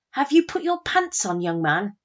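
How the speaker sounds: put-on loud, high call to a child; stern parental shout. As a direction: holler at your son like a cross mum. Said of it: "I lost my rag and I shouted".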